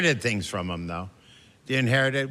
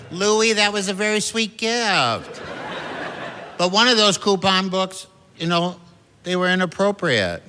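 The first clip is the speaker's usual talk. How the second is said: mom's voice; 7 semitones higher than usual. Retold or imitated. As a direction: play the fond, amused mother, pitched up. Mom answering son.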